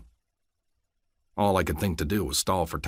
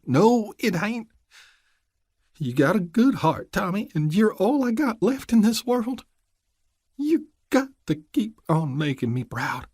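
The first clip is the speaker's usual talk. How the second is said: high, hushed, worn mountain woman; grieving old mother; 12 semitones higher than usual. Acted. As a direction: murmur like a broken old mother.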